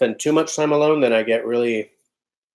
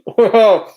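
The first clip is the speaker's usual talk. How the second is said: loud laugh; 10 semitones higher, 8 dB louder than usual. Real, not acted. Laughs at chat message.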